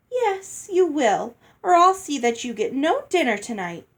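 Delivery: put-on high, haughty threatening girl; bossy older sister. A narrator's reading. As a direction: lord it over her. Hattie bullying, much higher.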